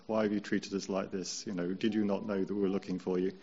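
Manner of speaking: soft, gentle, unhurried asking; very calm voice. Retold or imitated. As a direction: ask it calmly, no reproach. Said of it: "read it in a very calm voice".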